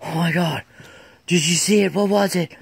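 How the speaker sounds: imitating buddy Lance gasping in panic; breathless panic. Telling how Lance panted after running, high and winded. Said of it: "He said".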